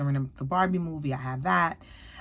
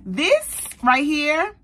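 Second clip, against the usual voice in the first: high, loud and slow; emphatic praise; 9 semitones higher, 6 dB louder, much slower than usual. Real, not acted. Praising the scent to viewers, slow loud emphasis. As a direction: lean hard on each word.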